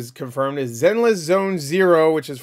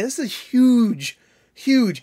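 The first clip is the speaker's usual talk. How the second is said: slow, higher and louder, leaning on huge; emphatic huge; 9 semitones higher, 5 dB louder, much slower than usual. Real, not acted. Frustrated about Starfield promotion, slow emphatic huge. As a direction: stretch out huge to hammer it home.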